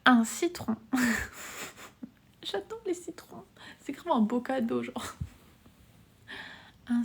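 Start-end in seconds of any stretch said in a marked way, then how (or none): 2.4-3.5 squeaky high. Pretend. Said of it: "reads chat in a squeaky voice".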